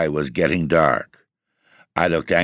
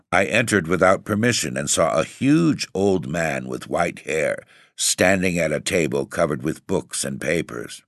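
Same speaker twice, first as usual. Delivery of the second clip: usual speaking voice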